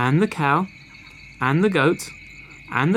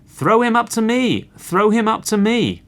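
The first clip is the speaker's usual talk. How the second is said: high, loud call from the rooftop; shouting; 9 semitones higher, 5 dB louder than usual. A narrator's reading. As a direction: shout it high, like a crowing cock. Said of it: "who shouted".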